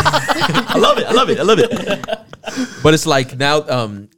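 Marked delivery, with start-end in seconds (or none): 0.1-2.1 high-pitched, laughing fake delight; laughing mock praise. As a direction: gush through laughter, playing along. Joining in the mock YouTube praise.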